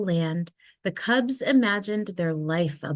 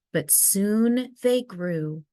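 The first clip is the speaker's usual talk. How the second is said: usual speaking voice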